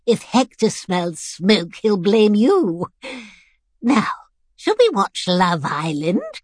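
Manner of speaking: lightly voiced mischievous old woman, slow relief then asking; an old rascal begging a smoke; sly old lady. Missus B scheming with the carer.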